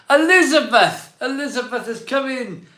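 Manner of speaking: sudden high, loud call of the name; excited shout-out. Real, not acted. Streamer greets Elizabeth's new membership, excited high loud call. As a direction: burst out with delight at the new member.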